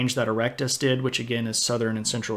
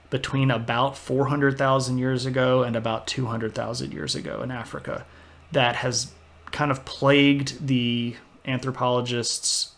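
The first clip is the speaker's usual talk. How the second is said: ordinary talk, nothing marked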